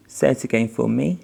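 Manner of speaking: encouraging. Acted. Coaching a child to read.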